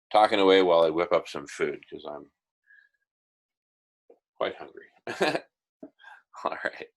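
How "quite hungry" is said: usual speaking voice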